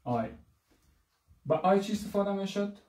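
ordinary talk

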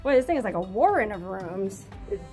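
normal talking voice

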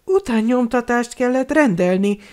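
magas, izgatott lányhang; meséld magasan, izgalomtól fűtve; izgatottan. A narrator's reading. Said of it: "mesélte az izgalomtól kidülledő szemmel". (izgatott, magas hangú újságolás)

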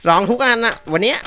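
ordinary delivery

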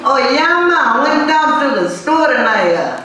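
high, sing-song Jamaican accent. Shown as a demonstration. Comic giving a sample Jamaican answer, hammed-up Jamaican accent. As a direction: ham up a Jamaican lilt, pitched high. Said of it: "in a Jamaican accent".

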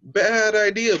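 bleated. Acted. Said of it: "bleated the sheep".

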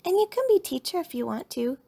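put-on high-pitched child's offer; lightly voiced little girl cub; friendly cub. A narrator's reading. Lizzie makes peace offer.

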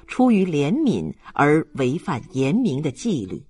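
ordinary delivery, nothing marked

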